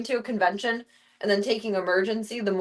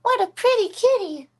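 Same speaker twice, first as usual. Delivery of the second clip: high, louder sing-song praise; pet talk; 11 semitones higher, 6 dB louder than usual. Real, not acted. Praising her cat in high pet-talk voice. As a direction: gush up high at the kitty.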